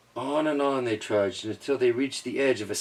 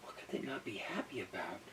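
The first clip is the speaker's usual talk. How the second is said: whisper; 3 semitones lower, 21 dB quieter, faster than usual. Real, not acted. Parent reading, aside.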